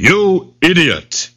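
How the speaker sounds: high, slow tiger bellow. A narrator's reading. Angry tiger insulting the jackal, bellowing. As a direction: bellow it with long vowels. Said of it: "bellowed the tiger".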